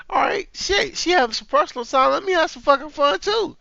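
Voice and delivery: high-pitched sarcastic voice; high, mocking imagined response; mock carefree reaction. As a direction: pitch it up, playing along with the idea.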